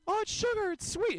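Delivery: very high, sugary mock-cute girl voice; hammed-up cutesy sweetness. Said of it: "they make it sound cute".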